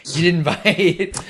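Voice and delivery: laughing teasingly at the dodge. Real, not acted. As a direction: tease them, cracking up mid-line. Teasing, repeats the line laughing.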